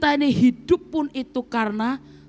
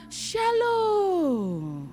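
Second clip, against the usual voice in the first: high, long falling hello; puppet greeting; 6 semitones higher, 4 dB quieter than usual. Pretend. Doll comes alive to greet the congregation, playful. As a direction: voice a doll sliding down the word. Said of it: "dia bisa berkata".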